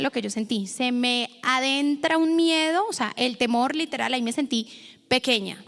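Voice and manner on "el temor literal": normal talking voice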